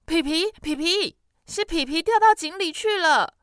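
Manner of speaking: 高声气喘地喊; out of breath. Acted. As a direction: shout it panting for breath. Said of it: "他气喘呼呼地说". (弟弟气喘呼呼地向妈妈大喊)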